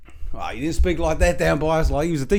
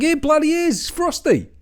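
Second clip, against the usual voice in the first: mate insisting; 9 semitones higher than usual. Retold or imitated. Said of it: "He's like".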